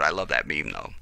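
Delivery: ordinary delivery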